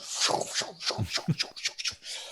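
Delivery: unvoiced rhythmic shushing sound effect; breathy swirling-room noise; imitated room whooshing. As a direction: hiss out a run of swooshes. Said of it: "the whole room just goes".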